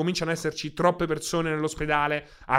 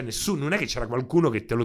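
ordinary talk

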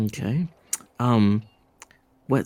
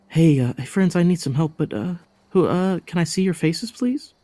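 put-on high, coaxing young woman; sweet pleading girl voice; 8 semitones higher than usual. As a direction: plead sweetly, pitch rising. Disguised woman charms strangers.

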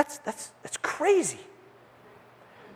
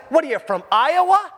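scornful mockery; 7 semitones higher, 6 dB louder than usual. Pharisees mocking Nicodemus.

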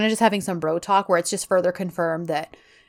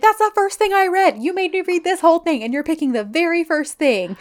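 high-pitched indignant protest; hammed-up outrage, easing down toward the end; mock exasperated disbelief; 8 semitones higher than usual. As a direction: protest as if your brain just fried. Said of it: "his brain fries".

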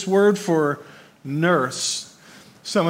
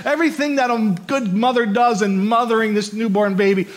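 higher and louder, pressing preaching; fervent sermon voice; full-force preaching; 6 semitones higher, 4 dB louder than usual. Acted. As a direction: lift the room with it from the pulpit. Preaching, continued.

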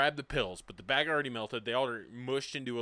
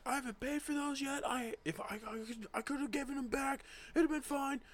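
imitated screaming; 13 semitones higher, 5 dB quieter than usual. Kid lamenting pills he never paid for, mock screaming. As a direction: act out a hysterical screamer at held-back volume. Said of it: "Screaming up and down the yard".

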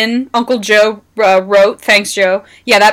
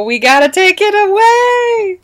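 mock moral alarm; 11 semitones higher than usual. Podcaster parodying anti-weed crusaders, mock moral alarm. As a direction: stretch it upward in exaggerated mock alarm. Mocks why weed was banned.

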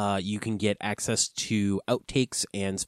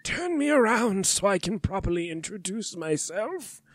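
Voldemort voice; 9 semitones higher than usual. Acted. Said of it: "The Voldemort voice".

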